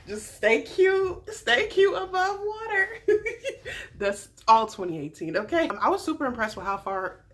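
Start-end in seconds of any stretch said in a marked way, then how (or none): 0.1-4.0 high, slow, laughing coo; teasing encouragement. Acted. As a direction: egg her on in a raised, amused voice. Teasing Becca in mock encouragement.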